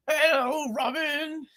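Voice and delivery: put-on high girly Starfire voice; breathy high alien girl; man doing a woman's voice. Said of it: "they loved when I used that voice for, uh, Starfire".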